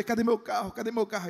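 high, urgent repeated question; eager searching. Retold or imitated. Pastor voicing a man hunting for his new car, eager. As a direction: look around eagerly.

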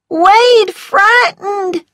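very high, loud, drawn-out wail; frightened Wade. A narrator's reading. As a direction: wail out in fear, slowly. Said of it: "It was Wade's".

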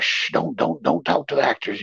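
whispered shush to the heckler; quiet stage whisper telling her to stop; hushing whisper. Retold or imitated. Actors hushing the old lady.

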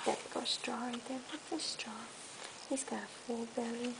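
hushed whisper aside. Real, not acted. Mother whispering to her toddler mid-story, hushed. Quiet aside while reading.

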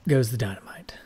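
whispered punchline. Pretend. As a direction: breathe it as a whisper. Finishing the boom joke.

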